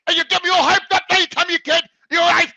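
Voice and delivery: yelling at the caller who dropped him; retold shouting. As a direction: shout her down in outrage.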